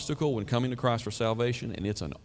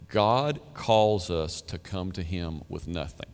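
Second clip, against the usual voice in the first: slow, deliberate delivery; solemn quotation; 3 semitones lower, slower than usual. Retold or imitated. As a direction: weigh each word of the quote. Quoting a preacher's weighty line.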